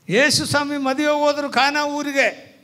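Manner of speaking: high, sustained preaching pitch. Real, not acted. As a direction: ring it out to the hall.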